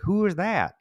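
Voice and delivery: high-pitched puzzled child imitation; self as first-grader. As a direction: ask it high, like a clueless little kid. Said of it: "I was like".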